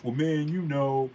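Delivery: slow, lightly whiny excuse; mock excuse. Mocking an excuse-maker, slow and whiny. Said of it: "never gonna make an excuse".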